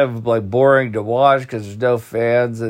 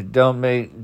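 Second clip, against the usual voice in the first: ordinary delivery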